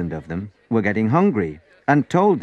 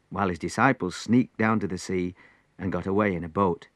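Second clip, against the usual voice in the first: plain reading voice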